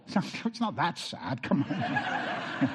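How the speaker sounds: breathy laugh over the words; real giggle he can't hold back. Real, not acted. Pastor laughs off the lost hamster story, real laughter.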